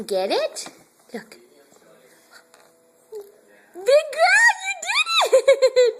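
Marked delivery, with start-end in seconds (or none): 3.7-6.0 cheer. Real, not acted. Cheering baby's success.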